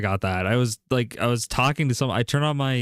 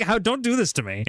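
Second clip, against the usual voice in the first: high-pitched mock pleading; retold exasperation, pitched up; exasperated plea; 10 semitones higher than usual. Acting out exasperation at customers.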